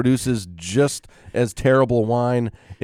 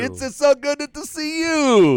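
put-on Italian accent; 12 semitones higher than usual. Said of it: "your Italian accent is dead on".

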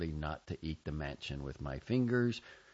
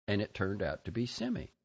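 usual speaking voice

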